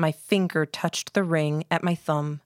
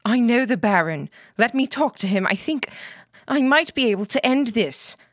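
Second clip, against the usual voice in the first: urgent pleading; 6 semitones higher than usual. Acted.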